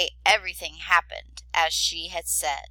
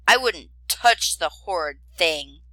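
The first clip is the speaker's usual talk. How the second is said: rude; 9 dB louder than usual. A narrator's reading. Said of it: "said the dragon very rudely".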